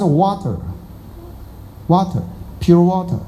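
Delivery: water，water，pure water said slowly and deliberately; careful water. Shown as a demonstration. Master modelling water slowly for students. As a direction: stretch out each water like a teacher. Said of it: "你要是water".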